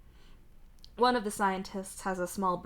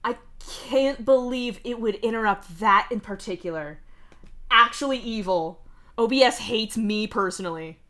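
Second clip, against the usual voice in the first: ranting; 3 semitones higher, 7 dB louder than usual. Real, not acted. Ranting about the interruption.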